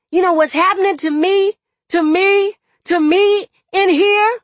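loud, high, fast angry tirade; furious outburst climbing to a frantic pitch; yelling in anguish. A narrator's reading. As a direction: build to a desperate high-pitched yell. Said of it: "I'm sorry I yelled".

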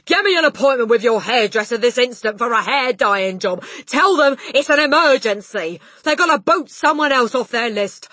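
loud, high-pitched commanding; urgent shouted orders. A narrator's reading. Father ordering wife to phone hairdresser, urgent shout. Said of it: "the father shouted".

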